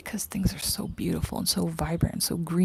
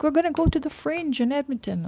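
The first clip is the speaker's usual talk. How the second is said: mocking eager friends; 9 semitones higher than usual. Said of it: "They'd be like".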